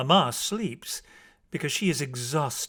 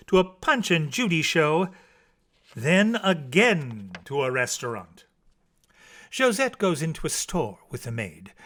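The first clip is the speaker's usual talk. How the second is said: ordinary delivery, nothing marked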